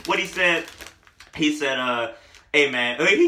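ordinary talk

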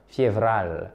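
"Fievral" with a lightly rolled R, pitched low. Shown as a demonstration. Teacher repeating the Russian month for learners, rolled R. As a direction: roll the R a little in "Fievral". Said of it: "Vous roulez un petit peu le R".

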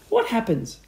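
alarmed wife's high voice; gasped. A narrator's reading. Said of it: "gasped Missus Twit".

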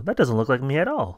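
usual speaking voice

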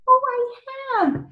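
very high-pitched little character voice; Hetty. Pretend. Listener answering the reader's question, tiny high squeaky voice.

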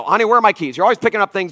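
loud, annoyed demands, rushing at the end; barking at family. Retold or imitated. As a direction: snap at the family, hunting for the keys. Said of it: "I begin barking at everybody".

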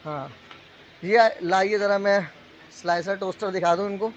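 normal talking voice

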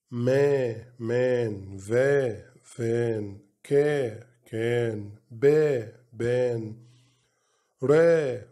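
each syllable then word, like فا then فات; phonics drill. Shown as a demonstration. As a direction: sound out "آت" slowly, part then whole. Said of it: "تبقى تتنطق إزاي؟". (تهجئة صوتية مقطع مقطع)

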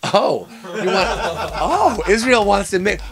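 ordinary talk, nothing marked

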